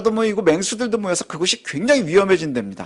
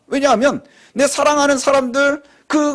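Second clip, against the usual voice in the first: ordinary talk, nothing marked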